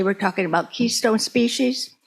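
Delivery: usual speaking voice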